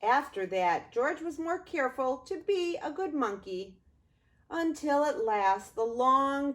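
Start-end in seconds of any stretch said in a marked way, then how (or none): none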